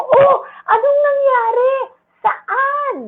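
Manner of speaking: high, alarmed fussing voice; worried parent. Shown as a demonstration. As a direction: make a fuss over the child's hurt. Parent fussing over hurt child.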